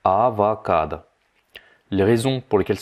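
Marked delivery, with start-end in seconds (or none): 0.1-1.0 "Avocado" drawn out as "a-va-kaad"; Russian pronunciation. Shown as a demonstration. Teacher repeats the correct sound, slow Russian pronunciation. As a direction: say "Avocado" slowly with a for the first o. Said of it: "ça se prononce avocado".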